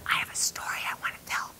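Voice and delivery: quick, hushed whisper; urgent whisper. Retold or imitated. Guest to radio host in freezing studio, quick whisper. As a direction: whisper it fast to get his attention.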